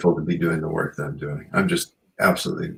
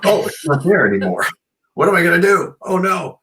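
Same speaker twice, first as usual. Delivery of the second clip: higher, louder hammed-up dismay; mock panic; 11 semitones higher, 6 dB louder than usual. Diner finds food gone, hammed-up louder panic. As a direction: play up the panic over missing food.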